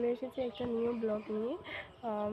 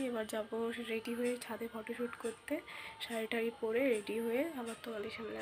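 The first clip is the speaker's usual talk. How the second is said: whispering; 7 dB quieter than usual. Real, not acted. Just woke, others sleeping.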